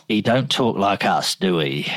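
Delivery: lightly imitated Londoner nan; a touch of working-class London. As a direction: quote her in a broad London accent. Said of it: "Londoner through and through".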